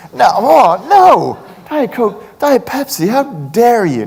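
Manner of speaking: high, loud hammed-up horror at Diet Pepsi; mock outrage. Speaker to a waiter offering Diet Pepsi, mock outraged shriek. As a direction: play it like they spat on your grandmother. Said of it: "borderline sociopathic response".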